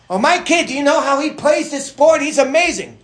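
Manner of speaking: loud, high mock gushing; excited bragging parent. Parent bragging about a child at sport, mock excited. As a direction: boast like an overexcited sports parent. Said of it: "parents get all excited about things".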